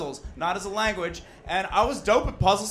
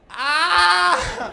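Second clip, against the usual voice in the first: high, loud mimicked yell; mock scream; 13 semitones higher, 9 dB louder than usual. Hit man answering the driver, high mock scream. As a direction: imitate the hit man's scream.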